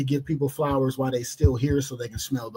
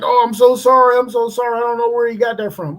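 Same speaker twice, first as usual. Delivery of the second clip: apologetic dad; 8 semitones higher, 6 dB louder than usual. Retold or imitated. Embarrassed father apologizing at door.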